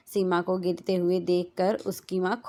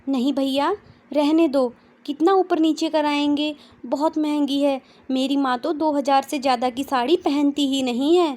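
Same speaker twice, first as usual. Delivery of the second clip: put-on higher, louder girlish refusal; young girl's voice; 7 semitones higher, 5 dB louder than usual. A narrator's reading. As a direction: politely brush off the seller. Daughter declines shopkeeper.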